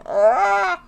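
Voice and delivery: sudden high, loud delighted squeal; excited squeal. Real, not acted. Gamer squealing at her own playlist, excited squeal. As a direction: squeal with joy at remembering a band. Recalls more favorite bands.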